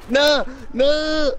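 high yelled protest; shouting no. Real, not acted. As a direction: shout it in alarm. Dying in the game.

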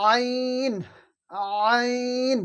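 আইন squeezed from mid-throat and held long. Shown as a demonstration. Teacher demonstrating a hard letter, long throaty আইন. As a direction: start আইন in the middle of the throat, then stretch it. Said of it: "কণ্ঠনালীর মধ্যখান থেকে".

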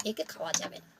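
dropped low and soft, almost to himself; quiet refusal. Acted. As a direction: mutter the verdict under the breath. Jackal refuses the corpse, repeated.

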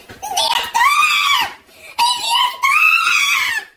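very high, drawn-out scream; panicked calling. Acted. Boy summons the principal, panicked scream. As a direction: holler for help with long vowels.

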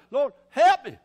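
loud cry for help; acted desperate father calling out. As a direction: burst out pleading, loudly. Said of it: "now he's crying".

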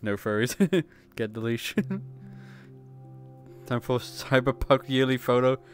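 ordinary talk

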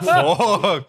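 high, loud startled laugh-cry; retold shocked reaction. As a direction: blurt it out, laughing in disbelief. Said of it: "And we were like".

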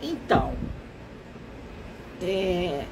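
ordinary talk, nothing marked

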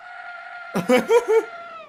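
high laughing yell; laugh-shout like the character; mock shouting laugh. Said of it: "diye bağırıyor". (yüksek sesli gülerek bağırma taklidi)

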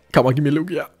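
normal talking voice

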